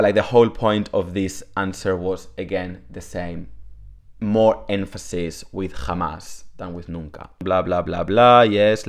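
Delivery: ordinary talk, nothing marked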